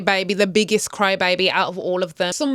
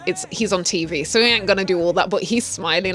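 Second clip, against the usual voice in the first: ordinary delivery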